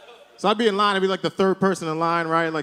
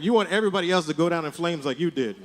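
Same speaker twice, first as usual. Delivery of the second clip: normal talking voice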